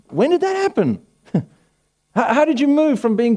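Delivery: high, incredulous squeak; mock shock. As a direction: feign astonishment at the news. Mock shocked pastor reaction.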